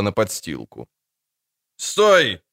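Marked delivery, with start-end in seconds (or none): 1.8-2.4 raised-pitch, mildly shouted halt; shouted order. A narrator's reading. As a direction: call out a sharp order to stop. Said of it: "крикнул первый из конных". (окрик командира)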